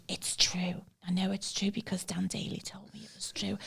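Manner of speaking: hushed whisper, insisting; defending the fact in a whisper to her mate; whispered insistence. Retold or imitated. I went.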